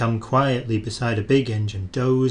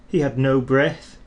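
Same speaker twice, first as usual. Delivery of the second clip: usual speaking voice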